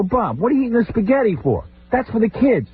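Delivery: high scolding complaint; exasperated scolding. Retold or imitated. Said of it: "I go".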